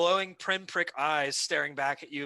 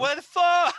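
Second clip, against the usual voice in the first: squeaky rat, high and loud; startled rat voice; 13 semitones higher, 10 dB louder, slower than usual. Retold or imitated. Rat reacting to the mockery, high startled yelp. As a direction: voice the rat, yelping in alarm. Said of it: "the rat is like".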